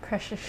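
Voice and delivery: "Precious" said as "preshush"; mimicked mispronunciation. Cohost echoing mom's word, imitating mispronunciation. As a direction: softly echo "Precious" with SH at the end. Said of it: "my mom can't say precious".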